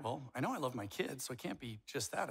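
usual speaking voice

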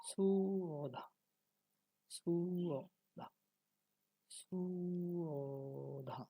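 সুঅধা very slow, with a long high 'suuu' falling onto 'odha'; Sanskrit pronunciation. Shown as a demonstration. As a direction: stretch সুঅধা further with each repeat. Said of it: "তাহলে উচ্চারণটা কি হবে?". (সুঅধা, টেনে টেনে সংস্কৃত উচ্চারণে)

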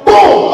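explosive high, loud "boom"; sudden booming impact; door slam noise. Retold or imitated. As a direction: imitate a slammed door with a shout.

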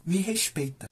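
soft, understated retort; quiet demand. Retold or imitated. Boy bragging to mom about swimming, quiet understated demand. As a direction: drop the volume for a muted aside.

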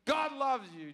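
mock-pious God loves you, God pitched high. Comedian mocking what Christians say, with sarcasm. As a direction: parrot the line mockingly.

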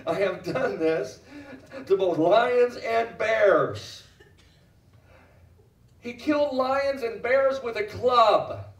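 slow, drawn-out proclamation; forceful preaching. Acted. Preacher retelling David's kills of lions and bears, forceful proclamation. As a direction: stretch each big word like a triumphant preacher.

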